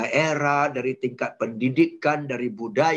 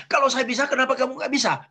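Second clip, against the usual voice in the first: annoyed challenge; 8 semitones higher, much faster than usual. Retold or imitated. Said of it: "kita gergetan kan?".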